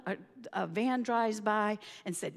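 usual speaking voice

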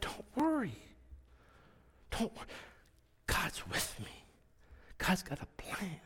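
whispered comfort, nearly voiceless; hushed reassurance. Retold or imitated. Man comforting his tearful boss, whispered reassurance. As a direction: whisper reassurance to someone crying. Consoling weeping boss softly.

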